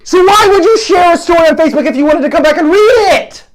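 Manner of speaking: loud, high exasperated demand; fired-up incredulous yell; heated. Real, not acted. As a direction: shout the question, totally fed up. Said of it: "Got a little heated there".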